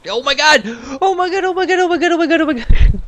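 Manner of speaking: high, loud panicked repetition; real panic. Real, not acted. Player nearly losing the game vehicle, panicking. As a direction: yelp it over and over in alarm.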